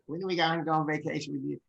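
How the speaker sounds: quick, high impression of a wife asking; imitating wife. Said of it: "I shouldn't make that voice".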